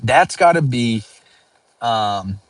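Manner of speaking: normal talking voice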